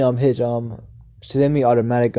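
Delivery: ordinary delivery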